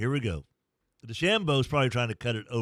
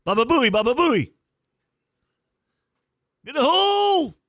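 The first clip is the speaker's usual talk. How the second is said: high, loud heckler shouts from the gallery; hammed-up golf crowd heckle; mock crowd yell; 13 semitones higher, 8 dB louder than usual. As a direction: bellow it like a rowdy fan. Mimicking golf crowd yells.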